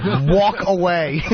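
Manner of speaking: "walk away" slowed and laughing; laughing brush-off. Retold or imitated. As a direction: say it slowly through laughter. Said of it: "he just went".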